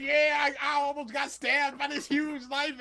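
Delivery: high, loud imitation of himself; hammed-up shouted boast; excited mock retelling. Host re-enacting his knife story, high and loud.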